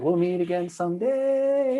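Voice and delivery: high, slow, otherworldly voice; gentle high inner voice, someday climbing. Retold or imitated. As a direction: float it up high and slow like a voice from inside. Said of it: "it was like a high".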